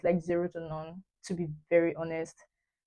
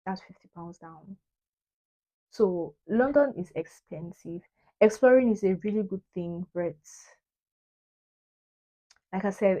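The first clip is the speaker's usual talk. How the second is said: ordinary delivery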